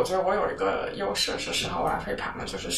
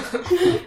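short high, loud laugh; sudden laugh at the accent; 11 semitones higher, 8 dB louder than usual. Real, not acted. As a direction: burst out laughing. Reacting to guest's accent.

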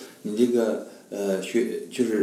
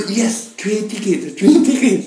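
higher, louder excited "Yes, train ticket"; finally understood, she bursts out; eager relieved foreigner; 8 semitones higher, 6 dB louder, slower than usual. Retold or imitated. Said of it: "他说". (外国女孩终于被听懂，兴奋高声喊出)

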